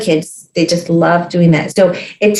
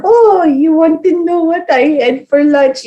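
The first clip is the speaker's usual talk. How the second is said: doting grandma; 7 semitones higher than usual. Retold or imitated. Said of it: "she was like".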